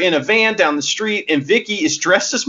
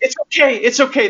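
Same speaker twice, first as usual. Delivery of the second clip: mock reassurance; 7 semitones higher, faster than usual. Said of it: "And then she goes".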